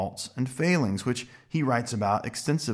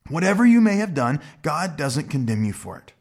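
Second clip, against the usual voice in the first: normal talking voice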